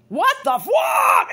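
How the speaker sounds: high, loud, slow disbelieving yell; outraged shout. Real, not acted. Reacting to Lenny's story, outraged shout.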